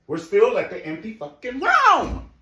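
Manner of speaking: loud, high-pitched complaint; exasperated outburst. Real, not acted. Host fed up with the empty room, exasperated yell. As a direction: build to a high yell on the last word.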